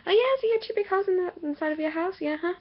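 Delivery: fast, high mock-chirpy voice; mocking sarcasm. Mocking family about holes in the house, sarcastic. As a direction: rattle it off in a silly high voice.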